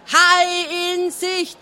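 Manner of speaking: high, slow shouted call through cupped hands; lookout's bellow. A narrator's reading. Schrottauge bellowing the warning himself. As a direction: bellow through a hand trumpet. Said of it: "brüllte".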